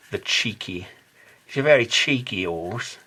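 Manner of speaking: put-on a light English accent, playful. Joking about the horse's cheek in English accent. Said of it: "please, don't do the English accent".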